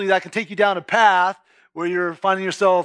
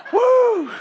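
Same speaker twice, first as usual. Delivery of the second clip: a high laughing woo; giddy laughing cheer; laughing whoop; 5 dB louder than usual. Real, not acted. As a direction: whoop with a laugh at his own joke. Laughs at own sex-sermon joke.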